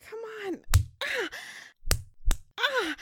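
high, loud cry of frustration; frustrated cry. Acted. Cries out at her lover to stop teasing, frustrated.

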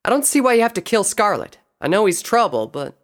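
high, loud, pleading objection; protesting friend. Acted. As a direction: argue urgently for mercy. Voices protesting Applejack.

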